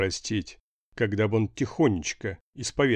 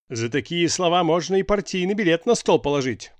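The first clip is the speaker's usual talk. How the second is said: furious boss, higher and louder; enraged; 7 semitones higher, 5 dB louder than usual. A narrator's reading. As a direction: rage at him like an old party boss. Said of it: "взъярился редактор".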